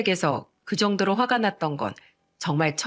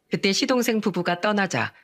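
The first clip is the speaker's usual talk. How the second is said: ordinary delivery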